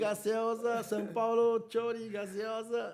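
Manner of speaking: high, loud chanted sales cry; street vendor's shout. Retold or imitated. Argentine vendor selling food to São Paulo fans, chanted shout. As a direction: hawk it in a drawn-out, sing-song call. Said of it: "o vendedor cantando, gritando, né".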